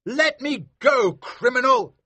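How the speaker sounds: high, loud shout of a pompous magistrate. Acted. Maskew shouts at the smuggler holding him, high and loud. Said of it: "shouted Maskew".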